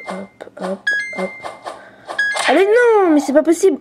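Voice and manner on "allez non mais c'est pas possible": real frustration. Real, not acted. A kid failing his tablet game wails in frustration. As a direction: wail it out high in real annoyance.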